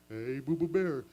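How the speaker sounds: low, loud cartoon bear voice; dad doing a cartoon bear voice; Yogi Bear voice. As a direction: do Yogi calling his buddy. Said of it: "talk to each other in cartoon voices".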